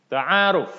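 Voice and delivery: TA'ARUF called out high and loud, falling at the end; intoned model reading. Shown as a demonstration. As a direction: announce "Ta'aruf" like a title for repetition. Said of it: "harus ada intonasinya".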